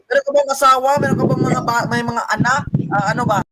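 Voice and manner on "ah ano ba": ordinary delivery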